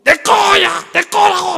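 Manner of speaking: put-on high, loud child shouting over a toy; screaming child. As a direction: yell like a toddler grabbing a toy. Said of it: "막 그러고 잡아 당기면". (장난감 뺏는 아이처럼 높고 크게 소리쳐)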